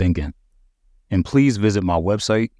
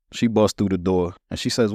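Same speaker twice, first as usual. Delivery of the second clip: ordinary delivery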